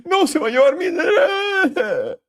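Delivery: high, panicked whine; mock panic over losing money; panicky trader. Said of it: "Todos los güeyes que están en long ahorita están de".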